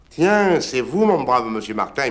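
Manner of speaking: cheery high greeting as Saint Peter. Acted. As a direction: greet him brightly, pitch up on Tiens. Saint Pierre greets the curé.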